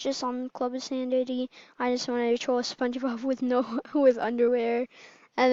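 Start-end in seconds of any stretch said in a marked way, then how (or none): none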